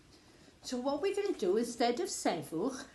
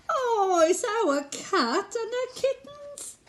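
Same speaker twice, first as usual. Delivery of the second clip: high delighted surprise; surprised; 11 semitones higher, 3 dB louder than usual. Acted. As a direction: gasp with glee at the find. Surprised discovery in story.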